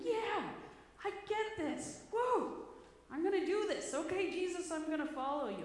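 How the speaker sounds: high, loud, gushing with excitement; an overjoyed convert shouting yes to Jesus; eager new follower. Retold or imitated. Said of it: "He was probably out there going".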